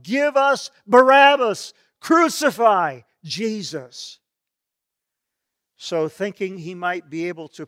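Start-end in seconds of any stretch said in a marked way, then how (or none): 0.0-4.2 loud, high outcry of an angry mob; crowd crying out. Acted. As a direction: shout it like a crowd demanding blood. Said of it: "the crowd cried out".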